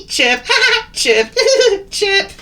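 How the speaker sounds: high, loud bird laughs between chirps; chuckling and chirping. A narrator's reading. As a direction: alternate squeaky chuckles and chirps. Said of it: "chuckling and chirping at the same time".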